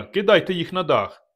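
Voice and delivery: high, loud command; shouted order. Acted. Narrator ordering torches lit, shouting. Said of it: "крикнув я".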